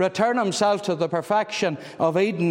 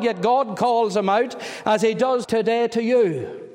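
raised, fervent preaching voice; 5 semitones higher than usual. Acted. Preaching at full force.

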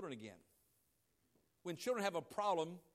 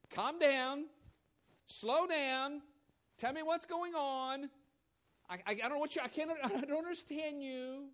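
put-on parent calming child; 6 semitones higher, 4 dB louder than usual. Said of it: "mom and dad says what".

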